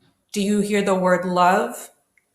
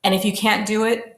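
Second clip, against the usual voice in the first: normal talking voice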